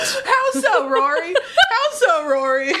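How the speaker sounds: squeaky high teasing voice; high mock voice. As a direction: pitch way up and tease.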